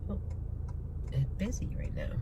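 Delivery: usual speaking voice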